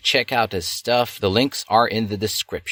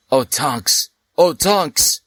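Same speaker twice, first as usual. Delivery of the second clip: mock passionate moan; 7 semitones higher, 4 dB louder, slower than usual. Moaning lovers' cry.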